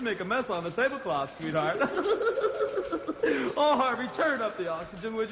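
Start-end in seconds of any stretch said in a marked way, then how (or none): 1.8-3.5 high cackle. Acted.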